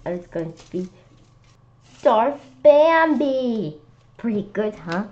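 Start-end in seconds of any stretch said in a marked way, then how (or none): 2.0-3.8 slow, loud, high announcement; proud reveal. A narrator's reading. Narrator proudly announcing his costume, slow, loud and high. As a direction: unveil the name grandly.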